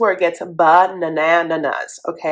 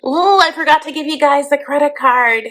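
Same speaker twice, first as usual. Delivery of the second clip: mock Mike; 7 semitones higher than usual. Host voices Mike.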